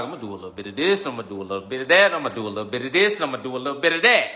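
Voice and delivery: fast, singsong listing; mock the indecisive person at speed; rapid mock waffling; rattling it off. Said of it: "That's why you like".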